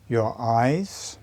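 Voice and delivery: calm, unhurried meditation guide with long pauses; measured meditation voice, letting each phrase settle; guided meditation. Real, not acted. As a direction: guide them inward phrase by phrase, leaving space between.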